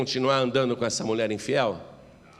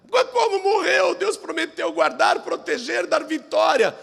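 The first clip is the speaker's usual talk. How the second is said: high, anguished disbelief; wailing protest; 12 semitones higher than usual. Acted. Grieving family protesting that God promised protection, anguished. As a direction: cry it out in grief.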